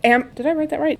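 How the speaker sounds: normal talking voice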